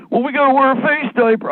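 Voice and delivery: high prissy mock voice; mock enforcer. Mocking a police state wannabe in a high prissy voice. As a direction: ham up an eager little enforcer. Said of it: "enforce what?".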